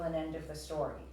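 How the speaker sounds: ordinary delivery, nothing marked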